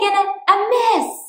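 ordinary delivery

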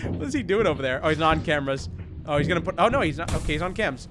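normal talking voice

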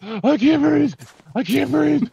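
high, loud mock gasping plea; hammed-up panic. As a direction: wail it like you're suffocating, all for show. Locked in cage, hamming panic.

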